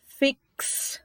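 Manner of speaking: "Fix" with a long, crisp "ks" ending; sound demo of X. Shown as a demonstration. Teacher asking students to listen, exaggerated ks ending. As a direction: draw out the k-s closing "Fix".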